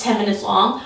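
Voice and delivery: normal talking voice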